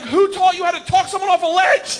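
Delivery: high, indignant acted yell; panicked yelling back. Terrified jumper answers host's warning, high indignant yell. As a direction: shout back in exasperated panic.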